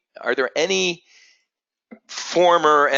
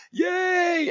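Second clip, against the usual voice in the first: put-on kid's cheer. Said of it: "he's like".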